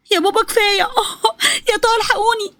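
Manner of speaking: high, crying plea for help; pleading cry. Acted. Daughter cries and begs her father to stop beating her. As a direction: beg him to stop between cries. Still being beaten, calls for help.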